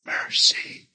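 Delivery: soft breathy whisper of one word. Retold or imitated. Lord whispering mercy to the grieving husband, hushed. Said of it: "whispered to me".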